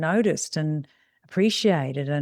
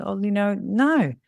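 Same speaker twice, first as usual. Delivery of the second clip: usual speaking voice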